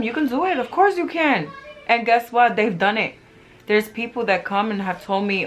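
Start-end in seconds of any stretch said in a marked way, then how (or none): 0.0-1.5 encouraging cheer. Retold or imitated. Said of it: "And I tell them".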